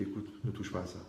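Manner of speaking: conseil plus doux. Pretend. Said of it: "Tu dis".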